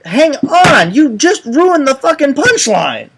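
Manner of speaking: real high-pitched, loud protest; exasperated outburst. Real, not acted. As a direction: cut in, annoyed at a spoiled joke. Storyteller protests spoiled punchline.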